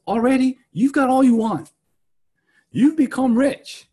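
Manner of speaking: mock the boasters with fake awe; sarcastic. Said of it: "it's sarcastic".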